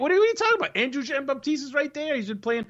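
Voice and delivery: high, incredulous objection. Real, not acted. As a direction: protest in disbelief. Cohost disputes a player claim.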